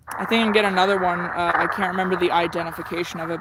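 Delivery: usual speaking voice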